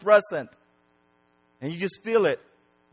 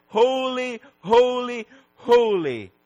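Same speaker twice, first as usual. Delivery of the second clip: high, drawn-out cry, stepping down; 7 semitones higher, 4 dB louder, slower than usual. Acted. Preacher voicing the seraph's cry in heaven, crying out. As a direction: cry each word out, ringing and separate. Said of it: "his cry of".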